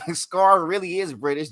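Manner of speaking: normal talking voice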